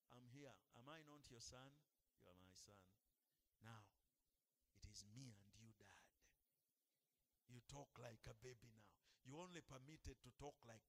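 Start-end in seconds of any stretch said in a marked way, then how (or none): 0.1-6.0 put-on soft, lower, gentle child's plea; talking like a kid. Preacher shows how to pray like a child to a father, soft. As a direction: speak to God like a little child. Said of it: "talk like a kid".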